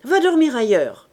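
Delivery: crié fort et plus aigu; cri sec. A narrator's reading. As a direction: renvoie l'ivrogne d'un cri. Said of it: "cria Enjolras". (Enjolras crie à Grantaire de partir)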